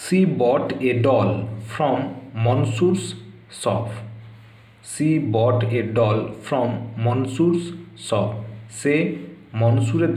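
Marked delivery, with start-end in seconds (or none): 0.1-3.9 each word slow, spaced out for students; slow, careful model reading; slow dictation. Shown as a demonstration. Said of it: "তো অ্যান্সারটা দেখে নাও". 4.9-8.3 each word slow, spaced out for students; slow, careful model reading; slow dictation. Shown as a demonstration. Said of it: "তো অ্যান্সারটা দেখে নাও".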